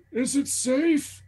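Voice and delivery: high, slow horse character voice; talking horse. Acted. Spooked horse turns to the goblin to ask, high slow voice.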